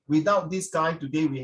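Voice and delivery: ordinary talk, nothing marked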